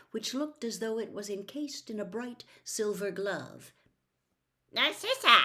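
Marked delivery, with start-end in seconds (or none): 4.7-5.4 high squeaky little man. A narrator's reading. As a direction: squeak out the name. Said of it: "in a squeaky voice".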